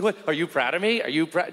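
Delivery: high, rising questions; pleading confusion. Retold or imitated. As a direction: beg for an answer, baffled. Son pleading to read dad.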